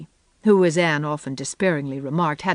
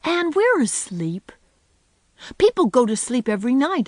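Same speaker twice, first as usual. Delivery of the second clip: put-on high-pitched, inquisitive little boy; Davy; 6 semitones higher than usual. A narrator's reading. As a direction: pester with questions like a small child. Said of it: "said Davy".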